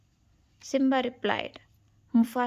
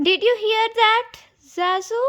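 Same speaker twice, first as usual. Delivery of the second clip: alarmed cub; 11 semitones higher, 7 dB louder than usual. Acted. Simba hears a yell.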